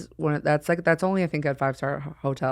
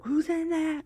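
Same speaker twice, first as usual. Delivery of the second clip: avatar voice; 11 semitones higher, 5 dB quieter, slower than usual. Pretend. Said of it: "a third avatar was like".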